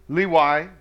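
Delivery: "Lewi" as lee-wee. Shown as a demonstration. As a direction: say "Lewi" with a w sound, not v. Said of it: "not L E V".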